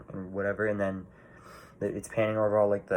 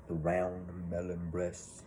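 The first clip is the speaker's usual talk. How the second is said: slow, softer lightly voiced quote; quoting a character; 3 semitones lower, 8 dB quieter, much slower than usual. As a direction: savour each word slowly. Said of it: "That's from when he's talking".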